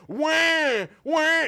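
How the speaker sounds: very loud, high mocking wail; hammed-up "wah" imitating a crying baby. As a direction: bawl like a baby, jeering. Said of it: "He's mocking a ba".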